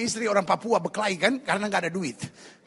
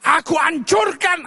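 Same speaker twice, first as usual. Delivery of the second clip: high, loud, slow order to a demon; forceful exorcism cry; commanding shout; 7 semitones higher, 6 dB louder, slower than usual. Acted. Acts believer commanding a demon.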